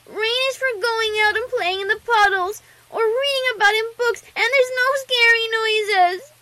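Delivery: put-on high, loud child voice, stretched at the end; squeaky little-girl complaint; protesting little filly. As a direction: argue shrilly like an indignant small kid.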